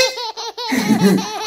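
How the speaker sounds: high, drawn-out giggle; real laugh. Real, not acted. Teacher cracks up demonstrating a letter to students, high laughing. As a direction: giggle helplessly at the silly sound.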